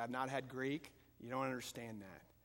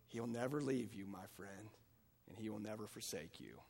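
ordinary talk, nothing marked

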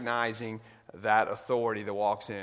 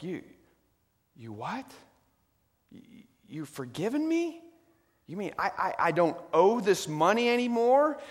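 stunned disbelief; 7 semitones higher than usual. Acted. Servant hears debt is forgiven.

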